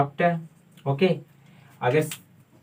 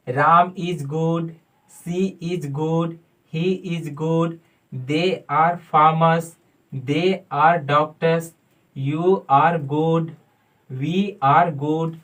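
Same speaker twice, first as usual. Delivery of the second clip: very slow, drawn-out example sentences; model sentences; much slower than usual. Shown as a demonstration. Teacher modelling sentences very slowly for students. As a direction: stretch each word for students to copy. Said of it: "आप बोल सकते हैं".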